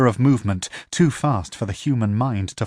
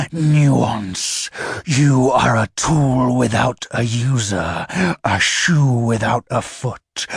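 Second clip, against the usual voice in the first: infernal contempt; slower than usual. Acted. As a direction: spit the insult down at the sword. Infernal scorns the sword.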